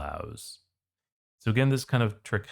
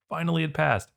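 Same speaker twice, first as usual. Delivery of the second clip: real excitement, pitch jumping up; excited; 9 semitones higher than usual. Real, not acted. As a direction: cheer Finally, it passed like a win. Excited about fifteen-year effort.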